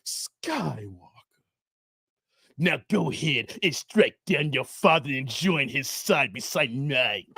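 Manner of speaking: low, slow menacing emperor rasp; Emperor impression. Streamer voices the Emperor tempting Luke, low and slow. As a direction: hiss it like the evil Emperor. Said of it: "I'm about to get into character".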